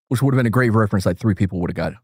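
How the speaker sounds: normal talking voice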